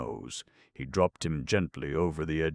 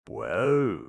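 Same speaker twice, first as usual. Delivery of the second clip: rumbling; 5 semitones higher, 5 dB louder than usual. A narrator's reading. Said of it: "rumbled the Cowardly Lion".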